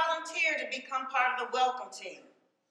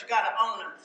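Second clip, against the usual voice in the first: ordinary talk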